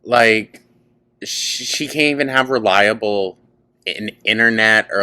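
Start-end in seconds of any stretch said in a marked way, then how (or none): none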